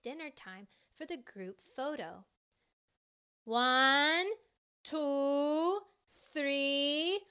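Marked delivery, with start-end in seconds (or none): 3.4-7.2 loud, raised, rising count; each number stretched and called out; yelled countdown. A narrator's reading. Parent counting down for the group photo, loud yell. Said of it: "a parent yelled".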